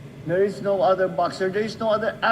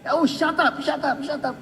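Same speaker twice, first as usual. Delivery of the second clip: high yelled shut up; shouting shut up; 9 semitones higher than usual. Retold or imitated. Wives yelling down husbands cheering for Hatton. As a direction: shut them down with a yell.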